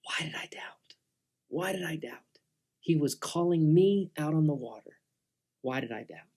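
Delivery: soft, whispered self-reproach; hushed inner thought. Acted. Soaked Peter in the boat asks himself, hushed regretful whisper. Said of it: "Peter was sitting there and thinking".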